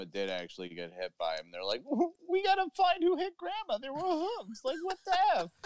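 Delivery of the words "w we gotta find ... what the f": squeaky high mock panic; high cartoonish squeal of alarm. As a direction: pitch it way up like cartoon kids. Mocking the film's characters.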